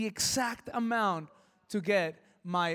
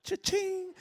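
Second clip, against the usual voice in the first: gleeful; 11 semitones higher than usual. Retold or imitated. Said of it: "I was just like".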